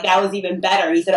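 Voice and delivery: ordinary talk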